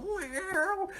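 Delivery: very high falsetto meow; high-pitched caterwauling meow; cat meow. Retold or imitated. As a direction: mimic a yowling alley cat. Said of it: "cats that are caterwauling outside going".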